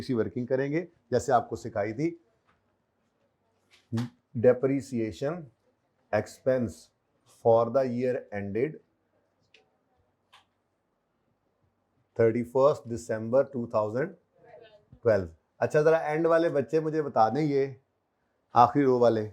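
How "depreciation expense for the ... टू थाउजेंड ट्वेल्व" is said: Depreciation expense heading stretched out very slowly; slow dictation. Shown as a demonstration. Teacher dictates the working heading to students, very slow. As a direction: dictate each word for writing. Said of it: "dictating heading to class".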